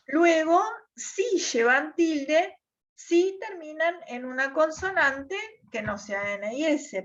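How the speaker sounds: ordinary talk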